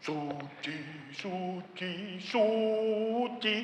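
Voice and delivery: barely breathed holy holy holy; soft as a prayer in awe; 1 hushed reverent worship. Shown as a demonstration. Preacher showing quiet reverent worship.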